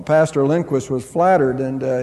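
ordinary talk, nothing marked

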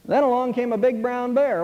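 ordinary delivery

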